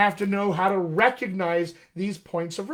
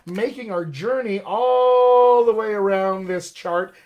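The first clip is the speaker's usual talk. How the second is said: emphatic; 4 semitones higher, 7 dB louder, slower than usual. Real, not acted.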